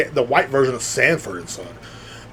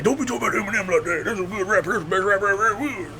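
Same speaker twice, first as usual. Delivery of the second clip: mocking offended fans; 7 semitones higher, 3 dB quieter than usual.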